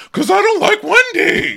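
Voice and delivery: high, loud mock squeal; hammed-up high admission; imitating Robin. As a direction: blurt it in a squeaky voice. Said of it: "She goes".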